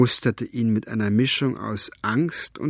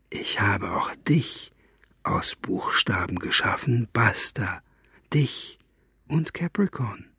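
whisper; 4 dB quieter than usual. Acted. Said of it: "senkte die Stimme zu einem Flüstern".

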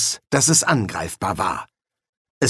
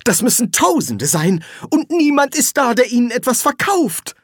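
high-pitched, aggrieved character voice; pained complaint, pitched high; sighing lament; 13 semitones higher, 3 dB louder than usual. A narrator's reading. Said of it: "seufzte Treibe mich selbst".

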